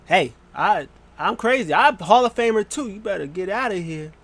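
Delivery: high, swooping mock-crazy threat; Beth Phoenix. Wrestler with a chair warning off attackers, hammed-up crazy threat. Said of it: "Beth Phoenix comes with the chair".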